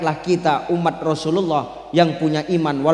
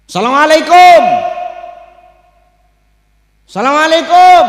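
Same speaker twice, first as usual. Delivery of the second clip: loud high call through a door; calling out a greeting; 9 semitones higher, 11 dB louder than usual. Acted. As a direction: shout the greeting at the gate. Prophet knocking at hell's gate. (Nabi berseru salam keras di pintu neraka)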